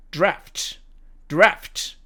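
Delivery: American "draft", front a; American demo of "draft"; American accent. Shown as a demonstration. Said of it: "미국식 발음으로 읽으면".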